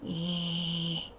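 Ж as one long steady buzz; voiced consonant. Shown as a demonstration. Teacher isolating a voiced sound for pupils, Ж held long. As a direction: hold a voiced Ж alone, with no vowel. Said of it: "Дзвінкий".

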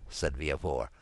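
plain reading voice

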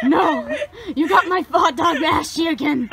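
laughing protest. Real, not acted. Hot dog covered in ash.

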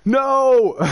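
loud, high cry of "no"; anguished cry. Real, not acted. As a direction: cry out in real dismay. Just lost the rare animal.

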